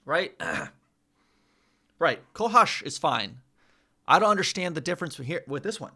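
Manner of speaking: ordinary talk, nothing marked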